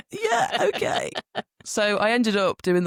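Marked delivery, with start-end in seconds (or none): 0.1-1.1 nervous laughing yes. Retold or imitated. Nervous reply to the farm manager.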